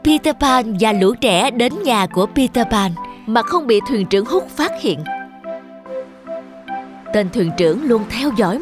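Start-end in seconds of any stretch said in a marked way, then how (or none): none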